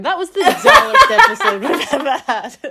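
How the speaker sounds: high squeal of a line, laughing through it; laughing mock summary. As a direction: quote it while cracking up. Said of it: "summing up the episode, going".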